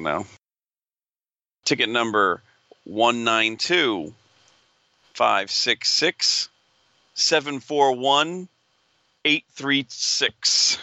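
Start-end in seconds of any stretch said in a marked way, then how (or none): 1.6-10.8 slow, drawn-out number calling; raffle caller. Acted. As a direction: call each digit like a raffle draw. Reads ticket number slowly.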